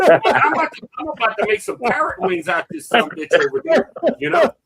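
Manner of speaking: mock outrage. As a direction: pretend to be ready to fight the bird. Mock threat to the parrot.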